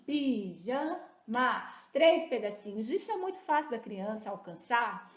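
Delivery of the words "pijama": "Pijama" split into slow syllables, pi-ja-ma. Shown as a demonstration. Teacher showing children how to count syllables, slow and split. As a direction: clap out "Pijama" one syllable at a time. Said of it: "Vai contando no dedinho assim".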